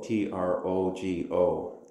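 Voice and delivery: T R O G O spelled letter by letter, slow; spelled out slowly. Shown as a demonstration. Teacher spelling T R O G O slowly for listeners. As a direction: spell trogo out slowly for the class. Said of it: "It's the Greek verb trogo".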